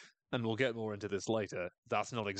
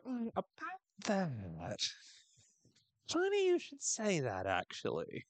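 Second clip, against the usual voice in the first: high, slow, knowing voice, rising to a much higher pitch; sly ironic aside; 9 semitones higher, slower than usual. Retold or imitated. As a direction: drop the hint with slow mock innocence. Self quoted, ironic reply.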